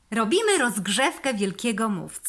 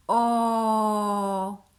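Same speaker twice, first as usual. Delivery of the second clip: whispered vowel; 4 dB louder than usual. Shown as a demonstration. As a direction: whisper a long round "O" with no voice. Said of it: "Jeszcze raz pokażę".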